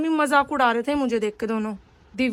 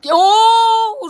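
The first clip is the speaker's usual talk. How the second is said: surprised oh; 7 semitones higher, 8 dB louder than usual. Real, not acted. Reacting to a sibling's remark.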